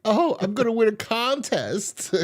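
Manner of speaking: high, gushing mock excitement; happy. Roommate explaining why she's happy, high excited gush. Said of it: "were you so happy about".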